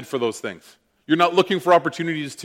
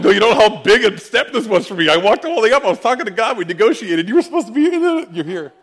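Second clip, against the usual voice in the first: higher, louder laughing rush of words; excited protest; 8 semitones higher, 7 dB louder than usual. Retold or imitated. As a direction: tumble it out, amazed.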